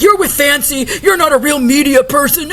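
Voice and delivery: normal talking voice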